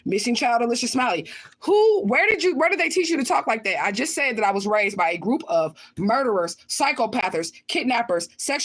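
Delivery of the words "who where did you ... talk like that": high, pushed mock question; incredulous mimicking. Repeating a viewer's question about her talk, incredulous. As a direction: throw the question back, pitch jumping up.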